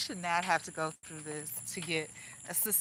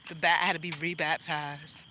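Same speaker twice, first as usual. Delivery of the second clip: ordinary delivery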